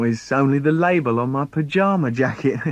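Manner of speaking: plain reading voice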